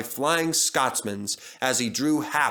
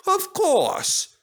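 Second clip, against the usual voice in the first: smiling; 4 dB louder than usual. A narrator's reading. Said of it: "smiled Duck".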